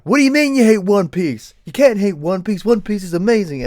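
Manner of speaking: high, loud mock outrage; put-on indignant drunken yell; going off. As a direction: imitate a drunk fan ranting in disbelief. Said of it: "goes off at another guy".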